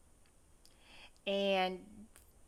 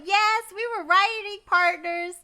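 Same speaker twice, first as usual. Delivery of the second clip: eager planner; 9 semitones higher, 9 dB louder than usual. Retold or imitated. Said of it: "She was like".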